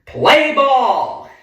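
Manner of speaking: high, loud ballpark shout; umpire's call. Acted. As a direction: bellow it like an umpire opening the game. Umpire call at game start.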